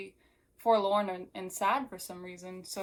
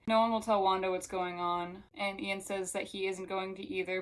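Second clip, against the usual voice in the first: normal talking voice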